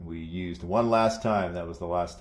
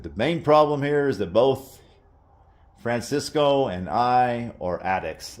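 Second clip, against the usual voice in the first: raised, higher voice, blurting it out; re-enacted confrontation, pitched up and forceful; letting it loose; 5 semitones higher, 6 dB louder than usual. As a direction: retell an outburst, loud and emphatic. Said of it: "Like, I let it loose".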